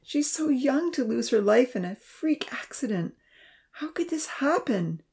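fading into a tearful whisper; crying. A narrator's reading. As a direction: let the grief drain the voice away. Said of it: "he cried".

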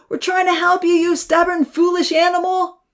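high, loud exasperated roar. A narrator's reading. Odin roaring at Brownie the cow, exasperated shout. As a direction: scold the stubborn cow. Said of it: "Odin roared".

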